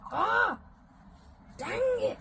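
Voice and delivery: high-pitched exasperated mock outburst; mock frustration. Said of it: "it's really annoying".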